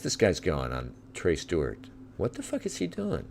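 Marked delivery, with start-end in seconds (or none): 2.2-3.2 sudden high-pitched disbelief; surprised outburst. Real, not acted. Streamer reacting in shock to a running protester, high-pitched disbelief. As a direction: shoot the voice up in shock. Watching a fast runner on stream.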